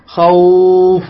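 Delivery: خوف slowly with a long "aw" glide; lengthened leen. Shown as a demonstration. Teacher gives students an example, long gliding leen. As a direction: draw out the و of خوف before the f. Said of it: "الواو ساكنة".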